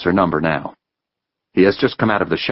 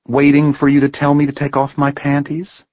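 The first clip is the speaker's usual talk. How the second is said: higher voice, defiant and quick; young woman, indignant; 8 semitones higher, 3 dB louder than usual. A narrator's reading. Heather challenging Ben's assumptions, higher and defiant. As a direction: push back hard at him.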